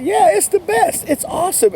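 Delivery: high, bright sales pitch gush; big old attitude. Retold or imitated. Salesman pitching a product to a customer, gushing and excited. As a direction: hype the product like it's the best thing ever. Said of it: "have that big old attitude about".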